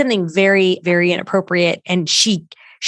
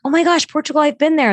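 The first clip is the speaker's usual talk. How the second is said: higher, gushing reaction to a shared place; mock excitement; 6 semitones higher, faster than usual. Fundraiser acting out eager reply to donor, excited. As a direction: play up delighted surprise.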